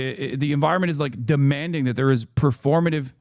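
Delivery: ordinary talk, nothing marked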